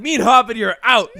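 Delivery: put-on loud, laughing kid declaration; defiant kid. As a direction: announce it like a proud little runaway.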